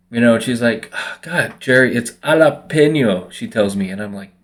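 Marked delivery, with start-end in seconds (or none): 0.9-3.3 imitated exasperated correction, pitched up; slow, drawn-out Spanish "jalapeno"; exasperated friend. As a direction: mimic an annoyed friend correcting you. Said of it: "she gets mad at me".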